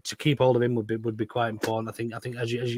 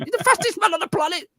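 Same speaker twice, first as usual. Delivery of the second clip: much higher mock German accent. Retelling De Bruyne's line in a mock German accent. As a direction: ham up a German accent for laughs. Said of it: "But in a German accent".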